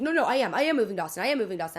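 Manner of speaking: loud, emphatic mock protest; mimicked insistence. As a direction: insist loudly, imitating a defensive man. Retells him insisting he's moving.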